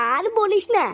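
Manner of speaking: normal talking voice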